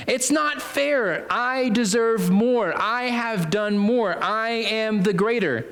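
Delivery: high, slow, sulky whine of entitlement; whiny complaint. Acted. Grumbling vineyard worker to landowner, high slow whine.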